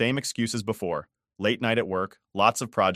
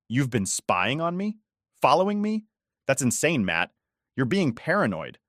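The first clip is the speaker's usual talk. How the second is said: defensive wife; 5 semitones higher than usual. A narrator's reading. Wife accusing husband of paranoia, higher indignant voice. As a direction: push back, offended.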